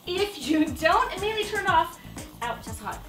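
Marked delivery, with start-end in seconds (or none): none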